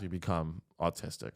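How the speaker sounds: ordinary talk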